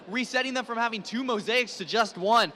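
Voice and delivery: usual speaking voice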